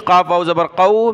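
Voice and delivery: ordinary talk, nothing marked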